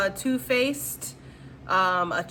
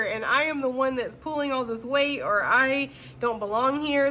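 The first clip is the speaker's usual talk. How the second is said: ordinary talk, nothing marked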